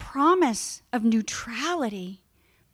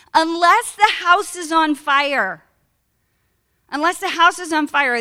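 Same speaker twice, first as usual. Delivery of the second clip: slow, loud and high, each word pressed; emphatic warning; 5 semitones higher, 6 dB louder than usual. Shown as a demonstration. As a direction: drive home the rule like a sponsor. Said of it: "really prick your ears up".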